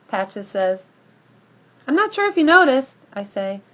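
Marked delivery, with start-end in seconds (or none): 1.9-3.0 high, loud and quick brag; boastful. Acted. Dog bragging to the other dogs, fast and cocky. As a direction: rush the boast out proudly.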